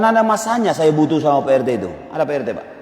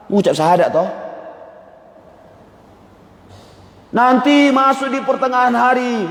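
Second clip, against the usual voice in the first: raised, high-pitched sermon delivery; preaching; 7 semitones higher than usual. Acted. Preacher to the congregation about daily prayer, fervent. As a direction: drive the lesson home to the congregation.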